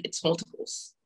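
breathy whisper of the news; retold whisper. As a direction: drop to a hush, like bad news. The nurse breaking the news.

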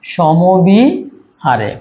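"সমবিহারে" very slow, middle vowel held and rising; slow sounding-out. Shown as a demonstration. Teacher showing students the spelling, "সমবিহারে" slowly drawn out in parts. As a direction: stretch "সমবিহারে", pausing before the last part. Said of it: "এই যে ব জফল আকার".

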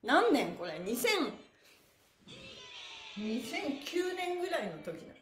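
normal talking voice

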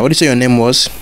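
ordinary delivery

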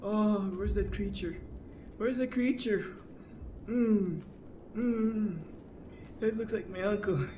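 high, loud nervous whimper; scared. Real, not acted. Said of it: "but I'm scared".

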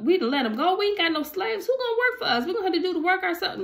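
high-pitched, incredulous paraphrase; mock dismay. Egyptians panic over lost slaves, paraphrased.

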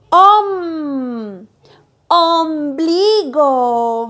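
"om" held, then "Ombligo" very slow and loud; blended syllable and word. Shown as a demonstration. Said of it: "Los junto y dice". (sílaba y palabra alargadas)

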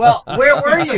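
high, slow, indignant complaint; mock indignant. Comic imitating his younger self scolding writers, high and slow. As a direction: whine it at colleagues who show up late. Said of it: "I'm like".

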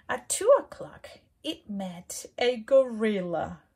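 ordinary delivery, nothing marked

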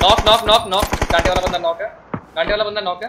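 loud, high knock callout; excited callout. Real, not acted. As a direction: shout the knock out. Knocks enemy, calls it out.

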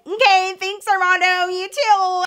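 high, singsong fake-polite goodbye; awkward politeness. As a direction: chirp it stiffly to escape, retold. Awkward polite exit, retold.

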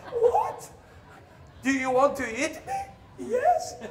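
very high startled cry, then laughing; high-pitched disbelief, half laughing; shocked squeak. Retold or imitated. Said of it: "shocked at cannibals".

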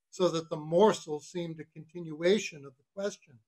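plain reading voice

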